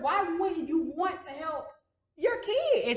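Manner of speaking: slow, rising in pitch, hurt anger; pissed off. Retold or imitated. Daughter retells confronting parents about help, angry plea. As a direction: plead it slowly, indignant. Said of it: "became extremely pissed off".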